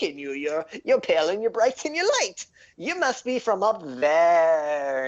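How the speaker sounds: very high, rushing gnome voice; excited gnome. Acted. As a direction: gush over the pale surface stranger. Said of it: "He's like".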